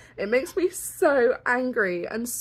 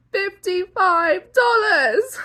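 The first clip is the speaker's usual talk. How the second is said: higher, louder, slow outraged repeat; Fifty-five dollars drawn out in disbelief; 8 semitones higher, 6 dB louder, slower than usual. Real, not acted. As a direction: repeat the price in shock.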